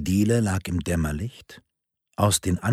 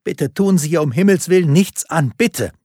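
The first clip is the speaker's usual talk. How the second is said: higher and louder, desperate begging; desperate plea; 9 semitones higher, 8 dB louder than usual. A narrator's reading. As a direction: beg frantically for your child's life. Mother pleads for her daughter. (verzweifeltes, lautes Flehen)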